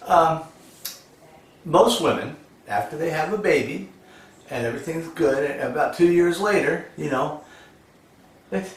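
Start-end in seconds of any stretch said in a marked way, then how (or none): none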